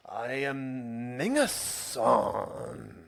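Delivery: very slow, drawn-out fake Japanese accent; mock Japanese accent. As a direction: stretch it out in a put-on Japanese accent. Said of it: "You want me to join in with the".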